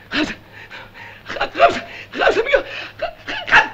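stammering in shock, voice climbing high. Acted. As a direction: choke on the number in disbelief. Shocked at five million.

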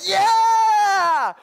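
long, high, very loud yell; imitated fan's all-out holler; roaring crowd cheer. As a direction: cheer like a whole stadium. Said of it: "whole stadium is like".

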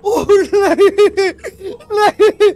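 high loud slow scream breaking into laughter; laughing shriek. Real, not acted. Gamer startled by the ghost's taunt, laughing shriek.

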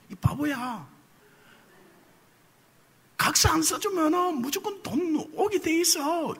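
high, soft half-swallowed scolding; a hint of a husband's frustrated urging; exasperated inner scolding. Husband secretly wants to scold his crying wife, high and soft. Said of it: "이야기를 해주고 싶은 거예요".

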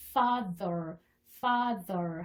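FATHER drawn out, with a voiced th. Shown as a demonstration. Teacher models father slowly, voiced TH. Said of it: "느 사운드가 나죠".

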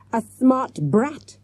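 loud, high shriek of fury. A narrator's reading. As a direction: snap at them, rising to a loud shriek. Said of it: "she shrieked".

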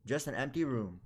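ordinary talk, nothing marked

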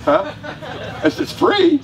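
high, laughing coaxing; laughing tease. Real, not acted. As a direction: laugh as you offer the free Coke. Teasing the audience with a free Coke.

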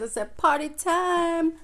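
high, slow sing-song; drawn-out party-time singsong; sing-song party cheer. Retold or imitated. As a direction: sell the party like a tease. Teasing the excited guy.

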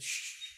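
long, soft shush; hushing shush. Acted. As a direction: hush someone who is shouting. Regular hushes the shouting boss.

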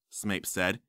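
usual speaking voice

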